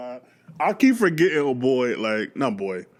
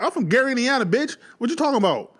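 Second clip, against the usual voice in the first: high, proud hometown boast; imitating Michael; 7 semitones higher than usual. Michael claiming Gary to anyone listening, high mock boast. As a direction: boast about Gary, higher. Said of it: "I hear Michael like".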